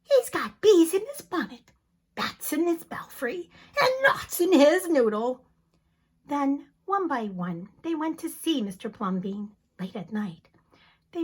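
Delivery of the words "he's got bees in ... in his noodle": louder, higher mocking gossip; higher and louder, tutting; scandalized neighbors. Neighbors gossiping about the man, louder and higher. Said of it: "the people said".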